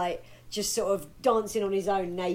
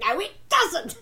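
retold shout; 10 semitones higher, 4 dB louder than usual. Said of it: "shouting at my computer going".

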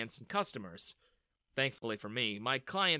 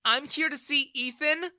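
higher, louder cold answer; angry reply; 12 semitones higher, 6 dB louder than usual. A narrator's reading. Bella answers her father angrily. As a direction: answer with open anger. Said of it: "she replied angrily".

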